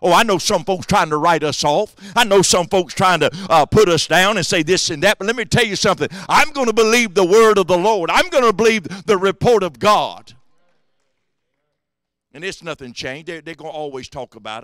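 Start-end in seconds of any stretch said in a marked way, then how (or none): 0.0-10.3 high, loud preacher's cry; preaching at full force. Acted. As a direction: preach it out to the congregation.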